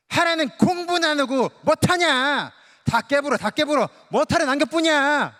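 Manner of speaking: loud, high scolding in Jeolla dialect; scolding Jeolla mom. Retold or imitated. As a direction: yell at the kid who broke the plate, Jeolla style. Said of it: "전라도 엄마는, 네, 이렇게 한대요". (전라도 사투리로 높고 크게 꾸짖는 엄마)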